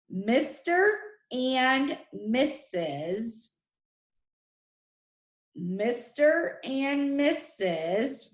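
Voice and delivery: "Mister and Missus" very slow, word by word; slow dictation. Shown as a demonstration. Teacher pointing out trick words to the class, slowly spaced. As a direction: draw out "Mister and Missus" with gaps between words.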